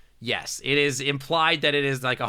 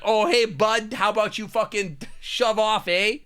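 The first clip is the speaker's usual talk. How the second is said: put-on Ontario hockey bro accent; 8 semitones higher than usual. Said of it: "hockey bro accent, where he's like".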